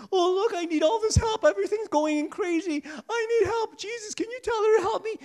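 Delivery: high, loud frazzled pleading; mock a flustered sister begging for help; frantic Martha. Sister begging Jesus for help, high frantic mock whine. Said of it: "When Martha was saying".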